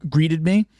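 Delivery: usual speaking voice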